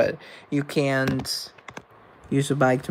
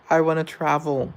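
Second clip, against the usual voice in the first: ordinary talk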